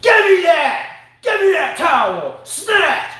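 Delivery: high, loud snatching demand; mock angry yell. Player snatching a towel from a ball kid, mock angry yell. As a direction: yell it out like a towel-grabbing diva. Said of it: "Sophia Kinnon comes up and goes".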